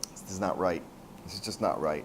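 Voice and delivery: low, hushed unease; subdued voice of conscience; quietly troubled. Acted. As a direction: think it to yourself, soft and low. Kid troubled by the robbers' plan.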